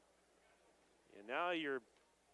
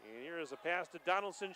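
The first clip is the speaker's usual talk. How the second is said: ordinary talk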